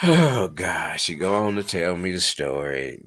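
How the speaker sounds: usual speaking voice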